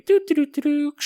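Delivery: high sung doo doo of a cart rolling; cart sound effect. Shown as a demonstration. Host mimicking a shopping cart rolling away. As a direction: hum the cart noise up high. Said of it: "That's a pretty good sound effect".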